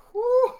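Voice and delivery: loud high whew of relief; relieved whew. Real, not acted. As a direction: blow out a big whew. Nervous draft moment, big exhale.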